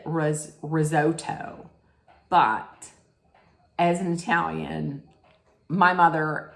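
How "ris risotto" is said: "risotto" with a long oh, ri-ZOH-toh; slow, deliberate American "risotto"; Americanized risotto. Shown as a demonstration. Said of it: "they say it".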